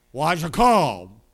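loud, very slow drawn-out deacon question. Retold or imitated. Deacon quizzing the kneeling boy, slow and loud. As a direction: drag it out like a stern deacon.

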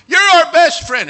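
high, loud, slow sing-song gush; mock gushing. Preacher mocking fickle church members, sugary mock gush. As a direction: parody their syrupy loyalty.

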